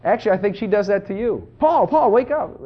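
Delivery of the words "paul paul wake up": high, loud, urgent name-calling; eager, raised-pitch wake-up call; excited waking call. Retold or imitated. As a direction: imitate rousing a sleeping spouse. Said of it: "you just get all excited, wake your wife up".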